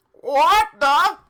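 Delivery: high, very loud startled yell; loud high yelp of surprise. Acted. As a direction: blurt it out in shock, high and loud. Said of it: "he yelled".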